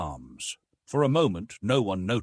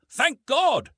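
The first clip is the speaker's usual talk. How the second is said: much higher, louder relieved cry; 8 dB louder than usual. A narrator's reading. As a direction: shout out your relief. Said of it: "he cried".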